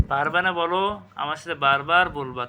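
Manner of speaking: ordinary talk, nothing marked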